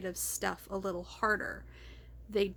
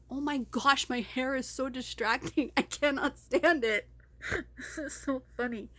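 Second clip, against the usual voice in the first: exasperated, louder outburst breaking into laughter; laughing but mad; 3 semitones higher, 3 dB louder than usual. Real, not acted. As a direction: laugh helplessly through real annoyance. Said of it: "I'm laughing, but I'm mad".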